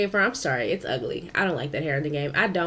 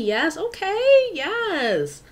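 high, drawn-out "yes, okay"; hyped sass; 10 semitones higher, 4 dB louder, much slower than usual. Real, not acted. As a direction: hype it up, stretching the okay. Hyped sassy exclamation.

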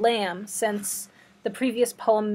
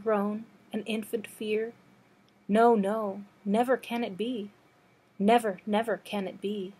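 measured, understated recitation; poetry reading; 4 dB quieter than usual. Acted. Librarian reading Blake's On Another's Sorrow, understated poetry reading. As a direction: read the verse evenly, pausing at line ends.

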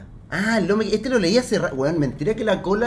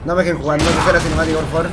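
panicking gamer; 3 semitones higher, 8 dB louder than usual. Real, not acted. Begging not to be given control during a cutscene.

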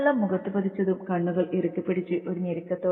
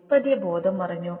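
ordinary delivery

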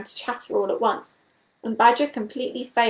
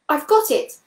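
bursting out with a sudden idea, higher and louder; a eureka shout; excited exclamation; 5 semitones higher, 5 dB louder than usual. A narrator's reading. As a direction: Toad struck by a bright idea, exclaiming. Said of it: "he exclaimed excitedly".

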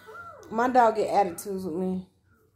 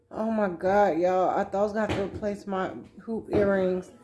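ordinary talk

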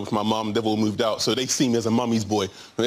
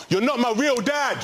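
high mock scream; hammed-up teenage yell, much higher; 12 semitones higher than usual. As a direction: yell it through the wall like a sulky teen. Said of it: "So I screamed out".

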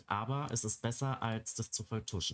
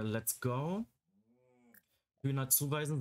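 ordinary talk, nothing marked

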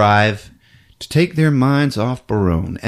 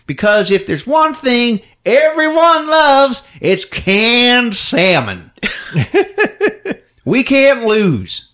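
a hint of a smarmy CEO; salesman executive; 13 semitones higher, 3 dB louder than usual. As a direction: sell the cannery like a huckster. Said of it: "He's like".

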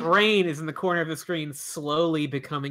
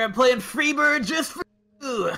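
excited shout; 9 semitones higher than usual. Pretend. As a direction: cheer it out. Cheering on a friend.

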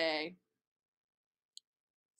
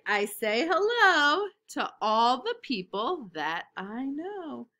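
loud, lively picture-book reading; reading to children; 4 semitones higher, 8 dB louder than usual. Acted. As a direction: read it big and bright for little kids.

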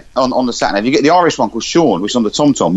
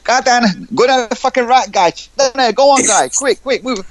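high-pitched, urgent street-slang sat nav; bossy council-estate guide, pitched right up; 10 semitones higher, slower than usual. Acted. Sat nav rushing a driver through council estate, high urgent slang voice. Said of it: "And council estate".

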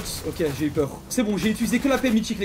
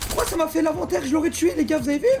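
frustration aiguë; 10 semitones higher, 3 dB louder than usual. Real, not acted. Frustré par l'inventaire ouvert.

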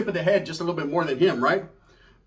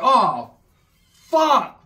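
much higher and louder oh fuck; shocked curse; 4 semitones higher, 6 dB louder than usual. Retold or imitated. Narrator reacting to the news, loud and high. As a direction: blurt it out in shock. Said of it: "I'm like".

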